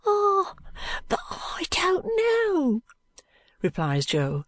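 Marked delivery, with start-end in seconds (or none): 0.1-2.8 very high, wavering fearful urchin. A narrator's reading. Jo doubting Allan's promise, high fearful voice. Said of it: "shaking his head fearfully".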